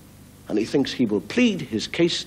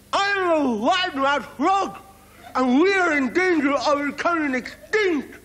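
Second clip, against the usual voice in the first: wide-mouthed frog calling. Acted. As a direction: rouse a sleeping monster, wide-mouthed. Frog wakes crocodile.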